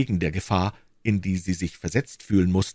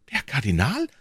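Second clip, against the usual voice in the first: alarmed cry; 6 semitones higher than usual. A narrator's reading. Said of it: "rief Madame Bonacieux".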